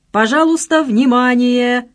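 loud, high, drawn-out public announcement; mock station announcer. A narrator's reading. Friend clowning through a megaphone, mock announcer. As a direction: parody a loudspeaker call, stretching the last word. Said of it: "подражая своеобразным интонациям диктора на вокзале".

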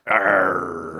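loud pirate growl "Arr". Pretend. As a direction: belt out a big pirate arr. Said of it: "Why won't you do a pirate voice?".

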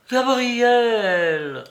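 high, drawn-out cry of delight; stretched joyful cry; surprised cry. A narrator's reading. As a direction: call her name in glad surprise. Joyful recognition of Gabrielle.

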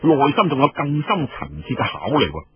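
ordinary delivery, nothing marked

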